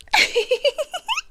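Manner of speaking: high, breathy little giggle; a delighted, creepy titter; amused villain giggle. Acted. Captor laughing at his victim's doubt, high giggle. Villain after "you're welcome.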